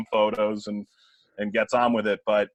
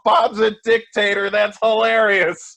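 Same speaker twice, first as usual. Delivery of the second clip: amused; 10 semitones higher, 7 dB louder than usual. Retold or imitated. Alums laughing at the story.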